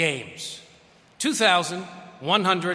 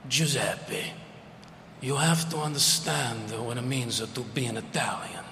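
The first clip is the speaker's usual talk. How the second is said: put-on Italian accent; 6 semitones lower, 5 dB quieter than usual. Old Italian uncle lecturing his nephew, lower and grave in Italian accent. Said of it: "that broken English, that Italian accent".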